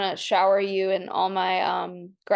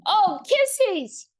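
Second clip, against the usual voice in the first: much higher squeal of delight; 12 semitones higher than usual. Real, not acted. Dog licks her face, high delighted squeal. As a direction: squeal as the dog kisses you.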